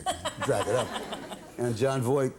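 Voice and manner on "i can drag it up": put-on Southern accent. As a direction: slip lightly into a Southern accent. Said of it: "Can you do a Southern accent?".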